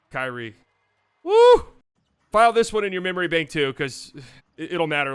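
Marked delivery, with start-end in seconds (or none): 1.2-1.7 woo. Real, not acted. Celebrating a play.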